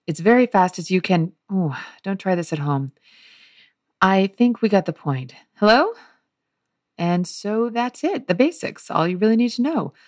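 lightly voiced young host showing off his family; chatty direct address to the audience as Oliver; a hint of a charming prodigal son; Oliver in soliloquy. Reads Oliver's soliloquy aloud.

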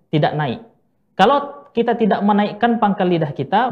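ordinary talk

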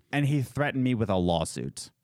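ordinary talk

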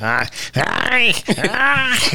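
lightly imitated guttural ah sounds; half-hearted mock growl, rising at the end; mock German growls. Podcaster mocking the interpreter's love scene, mock growl. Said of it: "she's doing this weird growl".

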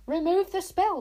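put-on enraged boy screaming an order; shouted. A narrator's reading. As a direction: yell it, losing control. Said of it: "shouted Tsar".

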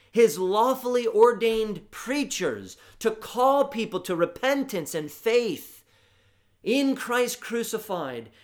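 high, loud proclamation; preaching at full force. Acted. Preacher rousing his congregation, loud and high. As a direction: drive it home to the pews.

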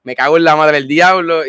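loud furious swearing; angry curse. Real, not acted. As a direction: spit out the curse. Cursing the hurricane.

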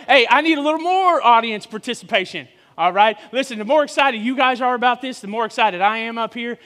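ordinary delivery

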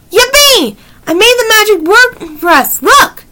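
high, delighted cheer; gleeful triumph. A narrator's reading. Annie shows Jack her magic worked, high excited exclamation. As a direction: cry out in a high voice, thrilled with the success.

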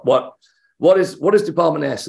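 high, urgent fan's question; excited asking. Retold or imitated. Fan rushes into the video shop, excited. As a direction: blurt it out eagerly.

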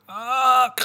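high, strained "ah" held out; mock straining groan from stretching too hard. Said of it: "I'm just gonna be like".

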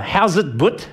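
South African greeting. Acted. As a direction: toss out a playful South African hello. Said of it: "if you're South African".

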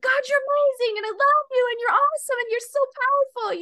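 high, loud, full-throated praise; shouting praises. Retold or imitated. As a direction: let it ring out across the backyard. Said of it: "I just started shouting his praises".

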